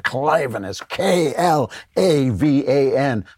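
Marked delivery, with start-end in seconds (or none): 0.0-0.6 "Klavan" drawn out with relish; showy name delivery. Shown as a demonstration. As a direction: announce "Klavan" with a flourish, stretching the start. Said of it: "you gotta say it like that".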